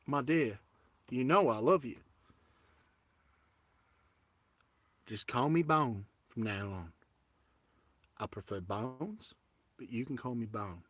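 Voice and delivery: quiet fake US Southern sweet talk; US Southern accent. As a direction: coax her gently in a mock Southern accent. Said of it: "I have U S Southern accent".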